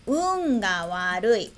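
slow, careful reading of "Un ga warui"; slow example read. Shown as a demonstration. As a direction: say "Un ga warui" slowly, as a model sentence. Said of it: "E o último exemplo".

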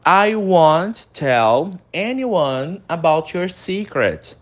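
very slow, careful "I won't tell anyone about your secret"; slow it down for learners; teacher modelling slowly; slow model reading. Shown as a demonstration. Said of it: "falando a frase inteira".

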